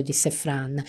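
ordinary delivery, nothing marked

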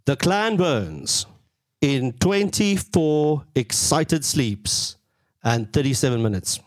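slow, drawn-out words with pauses between phrases; weighty, spaced-out countdown; dramatic countdown announcement. Real, not acted. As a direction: announce it slowly, stretching the key words.

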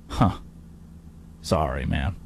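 low, soft aside; deflating sympathy. Real, not acted. Host letting down the overconfident Oklahoma City side, low and soft. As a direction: drop it low and quiet. Mock sympathy after the boast.

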